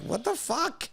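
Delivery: high-pitched shocked outburst. Retold or imitated. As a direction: squeal it in disbelief. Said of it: "I'm like".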